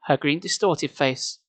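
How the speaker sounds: ordinary delivery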